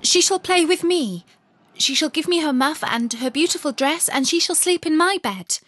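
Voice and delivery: put-on high, loud, demanding girl's voice; a spoilt child claiming a new toy; bossy robber girl. A narrator's reading. Robber girl demands Gerda as her playmate, high and bossy.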